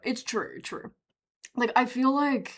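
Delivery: ordinary talk, nothing marked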